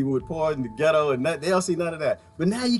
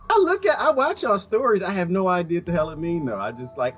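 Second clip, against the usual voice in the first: ordinary talk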